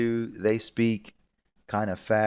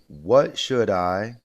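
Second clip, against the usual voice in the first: "What" stressed with a rising ask; slow rising "What should I?"; teacher modelling a question for students; model phrase, slow; 7 dB louder, much slower than usual. Shown as a demonstration. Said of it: "asking for a suggestion".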